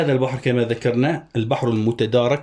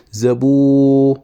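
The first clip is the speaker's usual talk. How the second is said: very slow, louder drawn-out syllables; syllable scanning; 2 semitones higher, 6 dB louder, much slower than usual. Shown as a demonstration. Teacher scanning a verse for students, slow loud syllables. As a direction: stretch each syllable for the students. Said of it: "أقف عند الواو".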